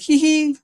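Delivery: high, light mock giggle; a quick girly hee hee; imitated giggle. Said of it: "Like she giggles".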